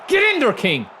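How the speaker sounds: high, loud celebration; excited cheer. Real, not acted. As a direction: cheer the player on. Celebrating a play.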